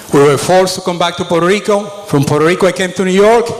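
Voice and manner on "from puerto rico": ordinary delivery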